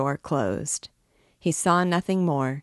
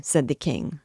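usual speaking voice

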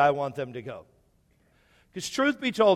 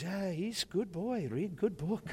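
imitating old man; 2 semitones higher, 10 dB quieter than usual. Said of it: "He goes".

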